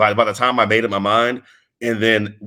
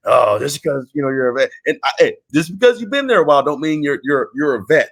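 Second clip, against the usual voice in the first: higher-pitched contentious retort; mock objection; 6 semitones higher, 3 dB louder than usual. Wrestler disputing who gets called a vet, contentious. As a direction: imitate someone disputing a title.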